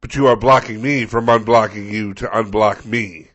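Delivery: loud, portentous proclamation, easing off at the end; Gandalf-style resident. Acted. Resident vowing revenge on plow driver, grand declamation. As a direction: declaim it like an epic hero. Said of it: "giving their best Gandalf".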